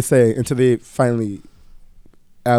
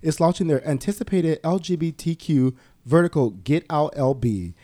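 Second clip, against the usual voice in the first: upbeat, higher sponsor-read voice; 5 semitones higher than usual. Acted. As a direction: sell it like a bright promo spot, a notch higher. Host reads a sponsor ad.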